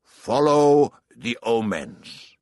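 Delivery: put-on slow, loud, resounding old king; ringing, drawn-out counsel from a remembered king. A narrator's reading. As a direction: proclaim it slowly like an old king's command. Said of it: "the old king had said".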